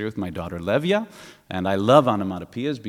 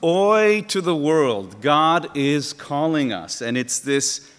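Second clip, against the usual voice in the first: slow, loud, high preacher's call; a slow loud ringing sermon climax; preaching; 4 semitones higher, 7 dB louder, slower than usual. Real, not acted. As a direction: proclaim it slowly like a call. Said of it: "preaching climax".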